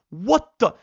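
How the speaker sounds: loud, high burst of shock; startled disbelief. Retold or imitated. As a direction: react as if suddenly shot at. Reacting to being shot at.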